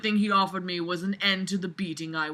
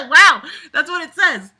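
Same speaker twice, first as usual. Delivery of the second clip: loud high laughter; laughing; 11 semitones higher, 15 dB louder than usual. Real, not acted. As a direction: burst out laughing. Bursting out laughing.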